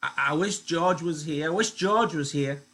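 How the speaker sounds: frightened Lenny whining for George. Acted. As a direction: whine for George. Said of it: "Lenny whined".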